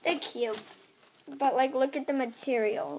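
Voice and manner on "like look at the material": usual speaking voice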